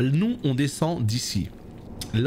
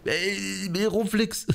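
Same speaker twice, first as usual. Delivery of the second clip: high, slow whiny excuse; mock whiny excuse; 9 semitones higher, slower than usual. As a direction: whine it like a caught cop. Mocking cops' excuse.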